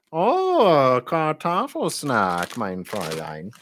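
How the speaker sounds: very drawn-out, swooping mock-German; hammed-up German accent, slow and softening; funny German accent. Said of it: "the funniest accent ever".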